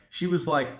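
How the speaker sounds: normal talking voice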